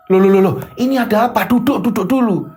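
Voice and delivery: high, startled questioning; alarmed intervention. Acted. Parent walking in on a fighting couple, high and alarmed. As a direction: step in urgently to halt a quarrel.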